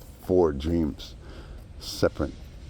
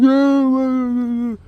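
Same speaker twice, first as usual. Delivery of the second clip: very high falsetto, much louder, then fading; hammed-up mock sob; 9 dB louder, much slower than usual. Teller mocks the man dabbing his cheek, hammed-up falsetto. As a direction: sniffle out a fake weepy yeah. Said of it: "you're really putting on a show".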